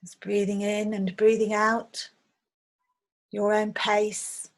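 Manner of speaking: unhurried meditation guide, long pauses between phrases; guided meditation. Acted. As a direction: gently lead listeners through a visualization, leaving space after each phrase. Leads a guided visualization meditation.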